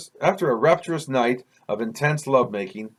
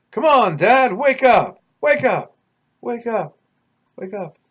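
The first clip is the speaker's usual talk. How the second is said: high loud shout fading into the distance; urgent waking call; 9 semitones higher, 6 dB louder than usual. Acted. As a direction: start yelling high, let each repeat die away. Waking dad, dream fading away.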